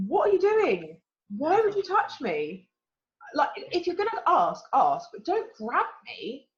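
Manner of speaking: high-pitched, drawn-out disbelief; indignant retort. Retold or imitated. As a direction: tell off someone who grabbed you, incredulous. Retorting to arm grabbers.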